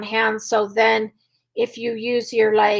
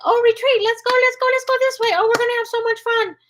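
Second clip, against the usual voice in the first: high squeaky voice; 12 semitones higher, faster than usual. Shown as a demonstration. Trainer showing owners how to call a dog from danger, high and squeaky. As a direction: sound like a party is waiting the other way. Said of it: "Use your high squeaky voice".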